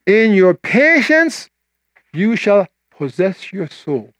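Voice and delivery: slow, weighty delivery; slow preaching read; slow scripture reading. Shown as a demonstration. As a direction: let each word land. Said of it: "preacher reads scripture slowly".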